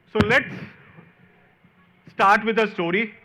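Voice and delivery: ordinary delivery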